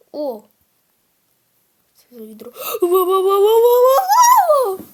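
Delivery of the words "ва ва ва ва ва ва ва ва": high loud wailing wah wah; muffled wah wah talk. Real, not acted. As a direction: wail wah wah in mock panic. Scared by bucket, wailing.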